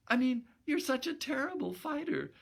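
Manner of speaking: put-on high young woman's voice, quick fix; hasty girlish correction. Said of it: "she hastily amended".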